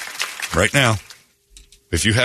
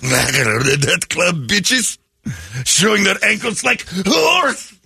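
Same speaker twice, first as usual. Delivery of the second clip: ranting cashier; 6 semitones higher, much slower than usual. Acted. Worker ranting slow.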